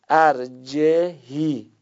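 ارجهی stretched out, ending on a long i; teacher slowly sounds out ارجهی; slow model reading. Shown as a demonstration. Teaching the recitation form slowly. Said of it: "طبق قاعده هم هست".

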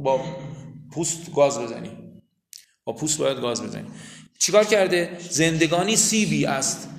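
ordinary talk, nothing marked

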